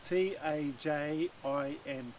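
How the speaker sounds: letters T A J I N spelled slowly one by one. Shown as a demonstration. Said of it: "It's spelled".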